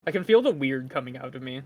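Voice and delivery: usual speaking voice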